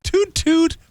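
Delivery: "Toot, toot" squeaked very high like a toy train; train whistle toot. Retold or imitated. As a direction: whistle "Toot, toot" like Thomas the Tank Engine. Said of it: "Thomas the Tank Engine".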